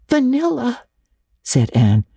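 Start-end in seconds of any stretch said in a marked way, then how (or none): 0.1-0.8 put-on small high girlish answer; mortified. A narrator's reading. Anne admits the flavouring to Marilla, mortified. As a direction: answer meekly, pitched high. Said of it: "her face scarlet with mortification".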